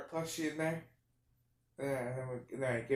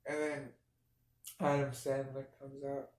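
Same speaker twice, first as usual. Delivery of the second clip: ordinary talk, nothing marked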